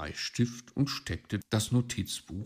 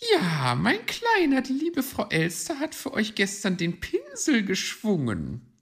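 high, loud magpie proudly explaining; proud high-voiced lady bird; 14 semitones higher, 6 dB louder than usual. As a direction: show off to the little one. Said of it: "erklärte sie stolz".

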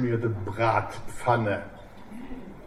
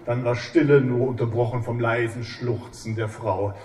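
ordinary talk, nothing marked

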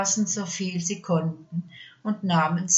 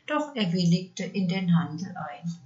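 ordinary delivery

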